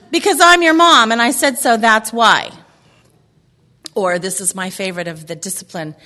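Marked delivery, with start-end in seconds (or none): 0.1-2.5 mock scolding mom. Speaker acting a mom ending an argument, mock scolding. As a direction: lay down the law as a fed-up mom. Acting out an exasperated mom's retort.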